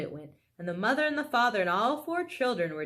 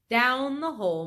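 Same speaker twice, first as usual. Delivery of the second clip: plain reading voice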